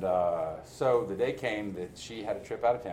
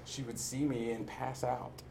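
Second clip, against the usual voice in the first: normal talking voice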